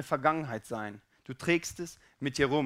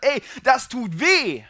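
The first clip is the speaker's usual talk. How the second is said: loud, high, drawn-out complaint; hammed-up outcry of pain and annoyance; fed-up protest; 8 semitones higher, 10 dB louder, slower than usual. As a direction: yell it like you have had enough. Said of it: "hat er die Schnauze voll".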